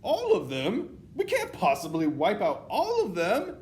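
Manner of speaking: high, loud incredulous protest; dismayed underling witch. A narrator's reading. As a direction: blurt it out high in disbelief. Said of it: "one witch at the end of the front row saying aloud".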